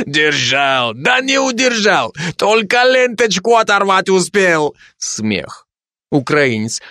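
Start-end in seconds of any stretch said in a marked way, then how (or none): 0.1-4.7 higher mocking Georgian-accented voice; mock him in a Georgian accent. Georgian soldier mocking the Ukrainian's boast, in a Georgian accent. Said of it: "каждый со своим акцентом".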